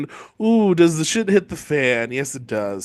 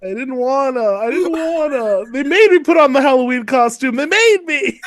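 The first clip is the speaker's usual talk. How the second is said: put-on high, whining little-kid voice; pouty, high whine of a kid forced into a costume; whiny kid; 6 semitones higher than usual. Complaining about being made to wear a costume, in a high whiny kid voice.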